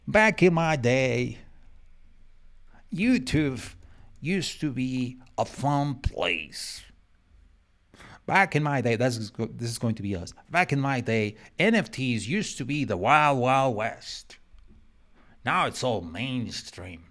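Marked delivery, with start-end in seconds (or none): 0.1-6.8 put-on drawn-out, louder old-timer grumble; old man. Old man recalling YouTube's fun days, put-on accent. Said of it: "sounding like an old man". 8.3-9.0 put-on loud, higher old-timer voice; old man. Old man reminiscing to the young, hammed-up voice. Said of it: "sounding like an old man". 10.5-17.0 hammed-up old man with an odd accent. Old man lamenting NFTs going mainstream, put-on accent. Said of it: "Why am I talking like that".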